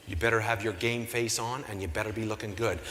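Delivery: low, quiet warning; stern caller. Retold or imitated. Gala boss warns him by phone, low stern voice. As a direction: imitate the boss, low and soft. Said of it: "she says to me".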